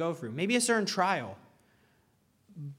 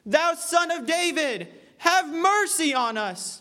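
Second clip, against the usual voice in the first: much higher, louder, slow pleading wail; desperate cry for mercy; 10 semitones higher, 4 dB louder, slower than usual. Retold or imitated. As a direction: wail after him to get his attention. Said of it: "screaming and shouting to God".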